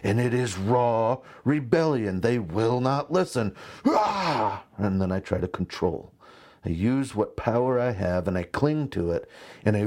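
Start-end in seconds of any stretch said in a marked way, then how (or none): 3.8-4.6 much higher exasperated "Ah!"; a dad at the end of his rope; frustrated cry. Retold or imitated. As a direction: burst out in frustration.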